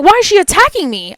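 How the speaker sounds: high-pitched, bewildered complaint; acting out disbelief at being singled out; baffled protest. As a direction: retell it in exasperated confusion, pitched well up. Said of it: "I'm so freaking confused".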